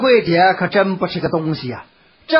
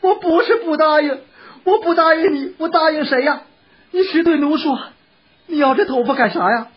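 high, pleading woman's voice; placating wife; 7 semitones higher than usual. A narrator's reading. Wife assuring her husband she is not refusing, placating. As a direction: protest devotion in a high voice.

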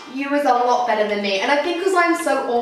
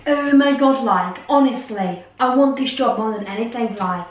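put-on Cornish accent gone Irish; 5 semitones lower than usual. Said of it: "You've gone very Irish".

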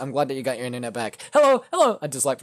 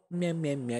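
imitated meow; 9 dB quieter than usual. Imitating his cat.

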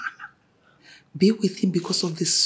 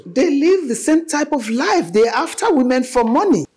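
insistent; 8 semitones higher, 5 dB louder than usual. Acted. Mother insists about the men.

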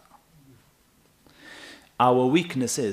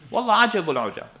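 amazed exclamation; 11 semitones higher, 6 dB louder, faster than usual. Retold or imitated. Retelling his amazement at the mosque.

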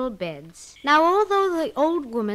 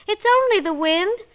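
put-on childlike voice; 10 semitones higher, 8 dB louder than usual. Children answering.

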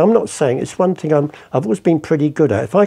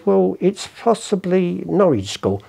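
slow, high, considered verdict; auction expert; 7 semitones higher, slower than usual. Acted. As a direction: imitate an expert weighing an attribution.

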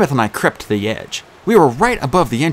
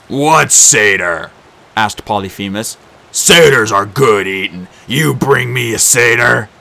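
slow, louder monster voice; a giant perking up at food; hungry Cyclops; 5 dB louder, slower than usual. A narrator's reading. Cyclops voice held.

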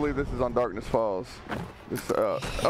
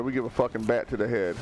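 ordinary delivery

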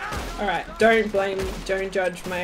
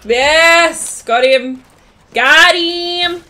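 over-excited loud victory cries; triumphant shout; 6 semitones higher, 10 dB louder, much slower than usual. Real, not acted. Player celebrating a hit, triumphant shout. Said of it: "Oh, that was cringe".

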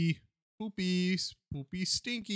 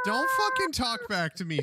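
mock scolding; 8 semitones higher, 3 dB louder than usual. Scolding the cat.

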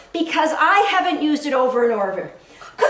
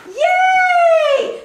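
a high, loud cheering shout; excited praise; 14 semitones higher, 6 dB louder than usual. Real, not acted. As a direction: cheer the puppy on. Praising the puppy in the bed.

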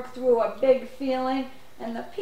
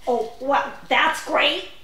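usual speaking voice

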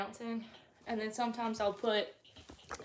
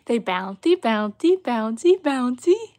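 loud sing-song chant, each word rising; 12 dB louder, slower than usual. Real, not acted. Mom chanting bouncy to her baby, loud sing-song. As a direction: bounce along with the words. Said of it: "my baby voice".

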